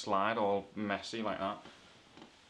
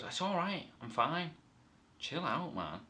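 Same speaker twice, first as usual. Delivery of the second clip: laid-back George; 6 semitones higher than usual. Retold or imitated. George voiced casually.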